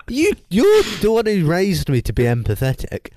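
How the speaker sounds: high, exasperated comic comeback; mock indignant protest. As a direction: argue back with playful outrage. Retold row with mum.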